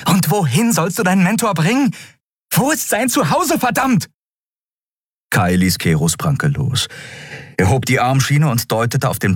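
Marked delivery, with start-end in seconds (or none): 0.1-4.1 hoch, drängend, zum Ende gesteigert; aufgebrachtes Verhör. Acted. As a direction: bohre immer erregter nach. Verzweifeltes Verhör, Endriel drängt. (verzweifelt und wütend gefragt)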